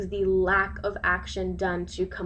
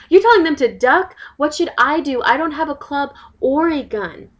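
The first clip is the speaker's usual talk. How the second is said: loud, higher-pitched protest; indignant outburst; 6 semitones higher, 11 dB louder, faster than usual. Acted. Resident confronts the police who were told to duck, indignant. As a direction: raise your voice in disbelief and outrage. Acted outrage at police sergeant.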